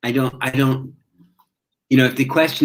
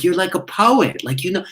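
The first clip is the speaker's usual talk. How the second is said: admiring mice; 7 semitones higher than usual. Retold or imitated. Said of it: "And they go, like".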